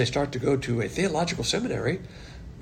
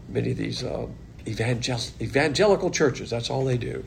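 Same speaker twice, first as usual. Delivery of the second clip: usual speaking voice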